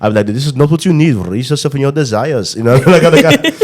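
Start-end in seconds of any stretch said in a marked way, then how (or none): 0.3-2.5 higher mock Indian guru accent; playful Indian accent, pitched up. Shown as a demonstration. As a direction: give sage advice in an Indian accent. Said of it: "I do the Indian accent".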